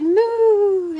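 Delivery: long, loud, high moo; cow's moo. Acted. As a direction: moo out like a cow. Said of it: "the cow said".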